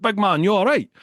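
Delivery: high called-out check, a mild yell; calling down. Retold or imitated. Mate calling down the stairs to check on him, mild call. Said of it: "Andy shouted from the top".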